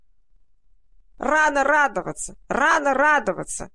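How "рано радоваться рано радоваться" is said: high, loud cawing taunt; cawing crow voice. A narrator's reading. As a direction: caw it at full throat. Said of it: "каркала она во всё горло".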